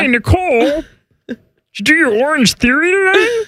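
high strained puppet voice; put-on squeezed high voice; Kermit. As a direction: greet her like a frog puppet. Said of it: "do you like the Kermit voice better".

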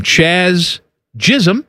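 Chaz Jism, Ch and J sounds swapped, drawn out slowly; mispronounced name. Retold or imitated. Host quoting the broadcaster's botched name, slowly mispronounced. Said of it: "Called him".

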